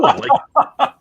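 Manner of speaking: breaking into a laugh. Real, not acted. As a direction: laugh through the word. Laughing at own story.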